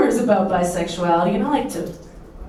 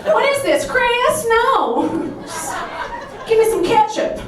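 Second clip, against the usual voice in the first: much higher, laughing mock Minnesotan voice; spice-fearing Minnesotan; 13 semitones higher than usual. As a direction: squeal like toothpaste burns you. Mocks Minnesotan spice fears.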